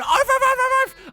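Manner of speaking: high, loud yapping barks like a begging dog. Pretend. Begging for a tile, high mock dog barks. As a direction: yap like a stray pleading for scraps. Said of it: "begging on the streets".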